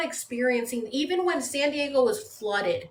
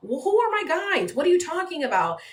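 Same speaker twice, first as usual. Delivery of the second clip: baffled frustration; 7 semitones higher than usual. Retold or imitated. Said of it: "I would get so frustrated".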